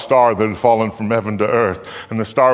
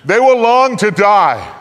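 higher, louder, slower swell on the phrase; emphatic longing; 10 semitones higher, 7 dB louder, slower than usual. A narrator's reading. Narrator stressing the torment of men seeking death, higher and louder. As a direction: lean into the anguish of it.

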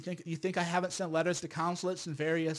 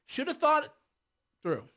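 ordinary talk, nothing marked

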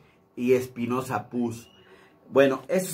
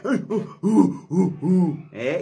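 high grunting "uh uh"; caveman grunts; 5 semitones higher, 3 dB louder, much slower than usual. Shown as a demonstration. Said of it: "ese no habla".

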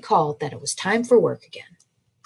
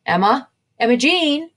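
calling across the orchard; 8 semitones higher, 6 dB louder than usual. A narrator's reading. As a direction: call her name from afar. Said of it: "Mama called from between branches".

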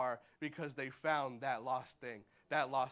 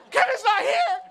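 very high, loud, near-laughing shriek; a mother losing it; mock scream; 15 dB louder than usual. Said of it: "she's like".

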